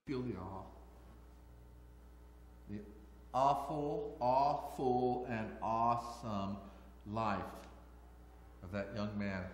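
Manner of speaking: soft, low, hushed preaching; a quiet, awed close to the story; hushed reverence. Acted. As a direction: let it sink in, almost to a hush. Reverent hushed reflection on Jose.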